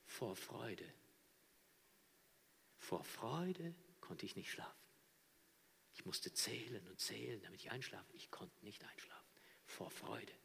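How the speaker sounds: hushed, softened voice; dreamy quiet joy of a convert; soft retold joy. Convert retells sleepless joy. (leise, verhalten erzählte Freude)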